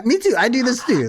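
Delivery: high, eager "me too"; high-pitched mock agreement; imitated listener agreeing. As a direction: squeal along like a fan who relates. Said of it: "other people out there are like".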